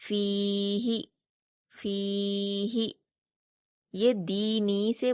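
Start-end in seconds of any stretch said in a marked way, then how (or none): 0.1-2.9 in فیہ the ی is held long and the ہ said quick; drawn-out recitation. Shown as a demonstration. Teacher modelling فیہ for pupils, long ی then short ہ. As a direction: stretch فی, clip ہ. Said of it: "ہا کو جلدی پڑھنا ہے اور فا کو کھینچنا ہے".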